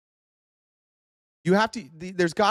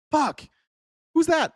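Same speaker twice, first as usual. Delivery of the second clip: higher, mock-exasperated swearing and asking; exasperated curse; 8 semitones higher than usual. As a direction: throw up your hands, groaning curse then a quick question. Exasperated cursing at each new group.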